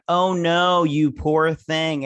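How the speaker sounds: high, loud, drawn-out fake pity; mock sympathy. Reacting to complaints about leaving on vacation, mock sympathy. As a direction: gush exaggerated pity, stretching the words.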